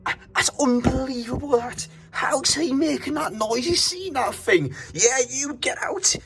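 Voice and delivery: high, incredulous exclaiming at the noise; high-pitched disbelief. Real, not acted. Hotel guest at the noisy driver outside, high-pitched disbelief.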